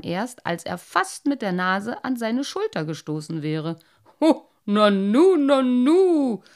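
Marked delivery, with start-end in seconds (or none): 4.2-5.4 put-on loud, slow astonished old carp, nanu rising; old carp bumps into them, nanu with a loud rising call; surprised. A narrator's reading. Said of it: "rief Cyprinius erstaunt". 5.4-6.4 nanu falling, higher and loud; astonished nanu dropping away; surprised. A narrator's reading. Old carp stares at them, nanu high and falling. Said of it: "rief Cyprinius erstaunt".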